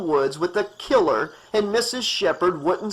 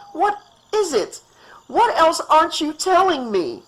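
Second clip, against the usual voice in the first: very high, pleading, louder; frightened woman imploring the doctor; 13 semitones higher, 4 dB louder than usual. Acted. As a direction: beg him for the truth. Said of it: "Amanda implored".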